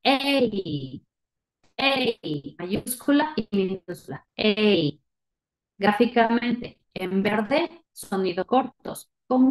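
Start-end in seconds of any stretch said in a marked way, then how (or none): 0.0-2.5 drawn-out "Ey" gliding into i; long A sound. Shown as a demonstration. Teacher recalls the long sound, stretched "Ey". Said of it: "¿Cuál era el sonido largo?". 4.3-5.0 drawn-out "Ey"; long A sound. Shown as a demonstration. Teacher repeats the long vowel, stretched "Ey". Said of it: "¿Cuál era el sonido largo?".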